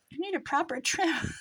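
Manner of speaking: playful posh British tone; a hint of a Brit hairdresser; English accent. Shown as a demonstration. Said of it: "That sounds English".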